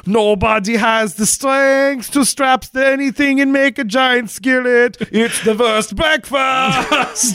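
high, slow gruff lumberjack. Acted. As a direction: ham up a lumberjack character. Lumberjack Nick character voice.

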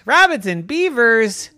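high, loud robot echo; robotic echo. Acted. Robot echoing Evan's suggested friends, loud robotic echo. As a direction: repeat it loudly as a disbelieving robot. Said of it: "in a robotic voice".

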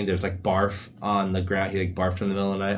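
usual speaking voice

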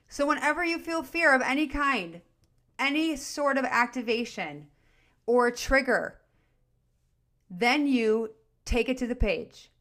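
ordinary talk, nothing marked

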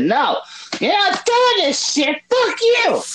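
squeaky high-pitched mock outburst; mock high yell. Gamer imitating his character yelling at the game. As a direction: shriek it like a cranky little voice.

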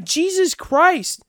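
high, slow comic disbelief; retold exasperation. Retelling his shock at friend's looks, mock exasperation. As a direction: draw out the scandalized reaction. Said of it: "I was like".

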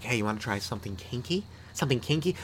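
soft, husky mock seduction.

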